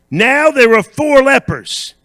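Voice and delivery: loud, high, slow proclamation; ringing pulpit delivery; preacher's announcement. Real, not acted. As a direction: proclaim it slowly to the congregation. Preacher launches into the story.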